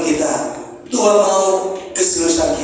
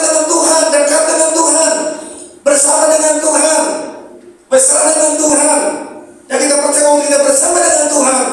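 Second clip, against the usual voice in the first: sung-out sermon cadence over music, higher; preaching; 6 semitones higher than usual. Acted. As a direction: lift each line like a chant to the church. (berkhotbah dengan nada tinggi)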